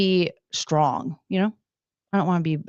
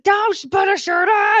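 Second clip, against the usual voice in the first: high loud mock shout; hammed-up no, put a shirt on; imitating Chrissy yelling; 12 semitones higher, 4 dB louder, slower than usual. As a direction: yell it like a friend stopping a mistake. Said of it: "Chrissy was like".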